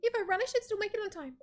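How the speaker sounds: high, fast, flustered rush; flustered hurry. Acted. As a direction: blurt it out while rushing off. NPC hurries off to a meeting.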